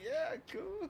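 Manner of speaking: very high, soft squeaky yeah; mock airhead voice. Fan going along with a crazy promo, squeaky high. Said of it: "And I'm just like".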